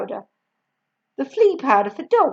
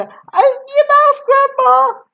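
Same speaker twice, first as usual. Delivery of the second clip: high, loud urgent call; urgent cry; 14 semitones higher, 9 dB louder than usual. A narrator's reading. George rushing water to Grandma, high loud cry. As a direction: shout it with the water ready. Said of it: "he cried".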